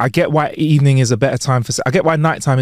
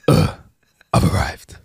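low, bored stoic grunt; stoic deadpan; 10 semitones lower than usual. Retold or imitated. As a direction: drop it low and unimpressed. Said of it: "That's what I say".

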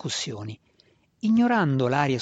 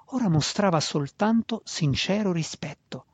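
usual speaking voice

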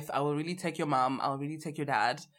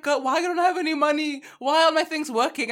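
put-on exasperated broke girl; 9 semitones higher, 5 dB louder than usual. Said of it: "when I'm like".

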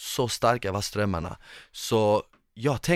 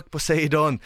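higher, louder mock battle cry; retold yell; 7 semitones higher, 4 dB louder than usual. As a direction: shout defiance at the sea god. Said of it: "och jag skriker". (spelat trotsigt skrik)

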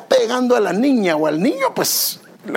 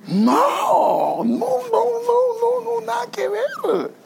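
high, slowed "no, no, no" protest; emphatic denial; much slower than usual. Retold or imitated. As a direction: insist it was nothing like that, voice jumping up. Pastor denies sister's complaint.

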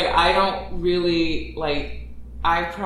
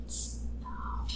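self-scolding whisper; 9 dB quieter, much slower than usual. Retold or imitated. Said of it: "But then I was like".

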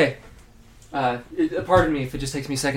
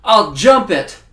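ordinary talk, nothing marked

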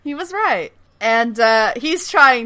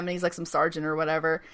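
ordinary talk

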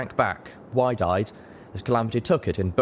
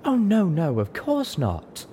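high start, slowing as it drops; hasty assurance; 9 semitones higher, slower than usual. A narrator's reading. Unicorn assures the offended newcomer, apologetic. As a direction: backpedal in a high voice, then settle. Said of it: "she assured the newcomer".

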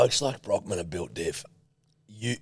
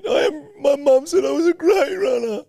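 much higher, shrieking tantrum; mock hysterical shouting; 4 dB louder than usual. Mocking a friend who quits running, high wailing shout. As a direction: throw a crying, yelling fit. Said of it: "and you'll go".

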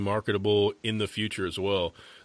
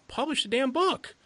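exasperated outburst. Real, not acted. Urging the hesitant author.

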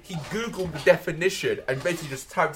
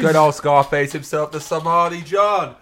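announcer intro; 6 dB louder, slower than usual. Acted. Co-host introduced on air.

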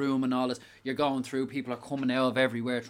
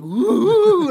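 very high, loud spooky whoop; clown whoop; 13 dB louder than usual. Retold or imitated. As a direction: whoop to scare the line of visitors.

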